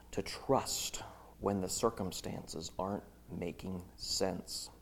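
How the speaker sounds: hushed. Real, not acted. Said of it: "hushed point on faith".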